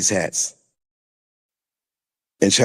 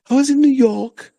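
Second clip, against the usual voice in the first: high-pitched mocking Ghanaian attempt at American; mock Ghanaian-American accent; 11 semitones higher than usual. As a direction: mimic a Ghanaian faking New York speech, pitched way up. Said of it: "she was trying to talk like".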